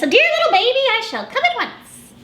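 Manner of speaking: put-on high, fussy old auntie. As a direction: chirp it like a busy aunt. Auntie Agnes voiced.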